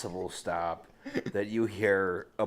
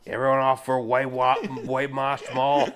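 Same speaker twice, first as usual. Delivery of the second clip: bus driver announcement; 4 semitones higher, 6 dB louder than usual. Retold or imitated. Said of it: "where the driver just said".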